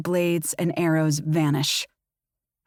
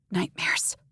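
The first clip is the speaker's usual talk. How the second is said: whispered echo; 4 dB quieter than usual. A narrator's reading. Narrator echoes his word.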